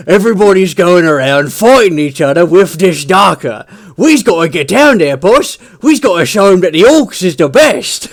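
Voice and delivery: rally the boss, all loud swagger; eager orc. Retold or imitated. Orc urging the war boss to join in, loud and eager. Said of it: "It's just like".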